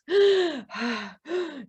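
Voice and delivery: catching breath after laughing; deep breath. A narrator's reading. Woman calming down after laughter, deep gasp. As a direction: draw a deep, recovering breath. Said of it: "she's taking those deep breaths".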